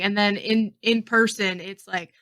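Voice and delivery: ordinary delivery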